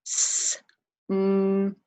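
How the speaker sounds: "S-M-R-T" said as separate sounds; sounding out smart. Shown as a demonstration. Teacher sounds out the word for the class. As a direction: break "smart" into its sounds one by one. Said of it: "Let's sound it out".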